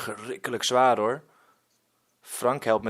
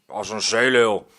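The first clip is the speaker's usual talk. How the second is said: gruff growl; 2 semitones lower, 7 dB louder than usual. Acted. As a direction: grumble back like a rough old sea dog. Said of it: "gromt woeste Willem".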